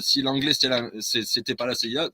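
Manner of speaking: ordinary talk, nothing marked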